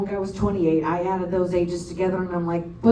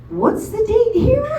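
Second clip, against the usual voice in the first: shocked disbelief; 5 dB louder than usual. Retold or imitated. Shocked checking passport ages.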